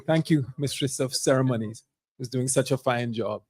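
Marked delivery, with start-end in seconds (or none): none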